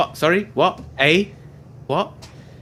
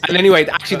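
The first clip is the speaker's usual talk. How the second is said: usual speaking voice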